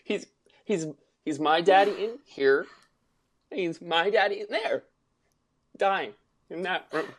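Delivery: hint of a tearful daughter; quoting Natasha, tearful. Retelling Natasha's plea about her dying father, mildly tearful. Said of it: "When she goes".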